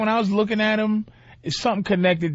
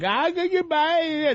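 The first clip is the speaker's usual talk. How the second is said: high, drawn-out mock foreign goodbye; hammed-up character voice, slow and high; Borat send-off; 9 semitones higher, much slower than usual. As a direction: stretch out a silly, high-pitched farewell. Imagines Borat leaving in character.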